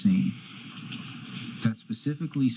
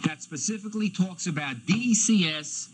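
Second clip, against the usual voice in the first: shouting; 7 semitones higher than usual. Real, not acted. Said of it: "shouting at my witness".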